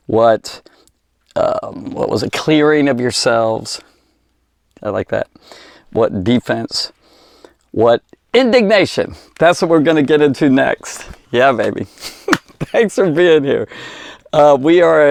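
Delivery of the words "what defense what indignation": normal talking voice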